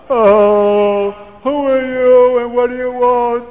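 a weeping man answering the door; tearful Reb Chaim. A narrator's reading. Reb Chaim, full of tears, asks the stranger at the door, choked and loud.

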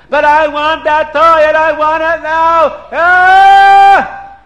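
put-on high, very loud spoiled-child screaming; screaming tantrum. Little prince refused a toy, screaming tantrum. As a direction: yell it, building into a scream. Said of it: "he starts screaming and yelling".

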